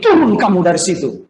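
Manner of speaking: imitated taunting demand; jeering command. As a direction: call it out like a heckler. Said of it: "Ketika dia bilang begini".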